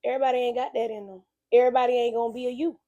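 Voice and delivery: usual speaking voice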